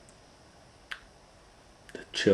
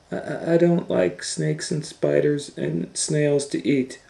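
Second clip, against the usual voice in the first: put-on higher, stammering child voice; higher timid girl; Kate, nervous; 9 semitones higher than usual. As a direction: stutter out the complaint.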